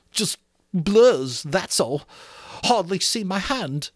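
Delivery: ordinary delivery, nothing marked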